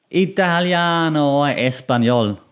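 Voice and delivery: drawn-out singsong Italian and Spanish accent; melodic Italian and Spanish. Shown as a demonstration. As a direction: stretch the vowels in an Italian and Spanish lilt. Contrasting melodic languages with German.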